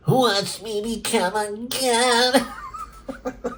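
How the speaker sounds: very high, slow, nasal squeak; pinched nose voice. Acted. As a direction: read it holding your nose. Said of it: "I gotta plug my nose".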